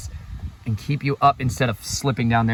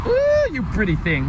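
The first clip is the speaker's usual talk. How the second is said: gushing admiration; 11 semitones higher than usual. Real, not acted. Man admiring the trees on his trip, gushing admiration. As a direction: coo over a beautiful sight.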